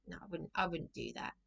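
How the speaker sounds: usual speaking voice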